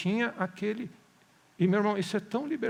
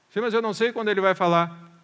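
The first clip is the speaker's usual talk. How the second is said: louder, higher objecting listener; raised-voice mock protest; protesting objection; 3 semitones higher, 7 dB louder than usual. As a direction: object loudly, like an impatient churchgoer.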